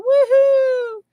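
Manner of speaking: high loud woohoo; joyful cry. Real, not acted. Streamer cheering milestone to chat, high loud woohoo.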